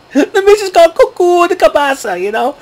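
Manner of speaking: giggling through the line, pitched high; can't hold back the laugh. Real, not acted. Laughing at Miz's antics.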